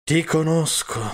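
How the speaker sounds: mildly sighed reply; sighing. A narrator's reading. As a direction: say it as a resigned sigh. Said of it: "affermò con un sospiro".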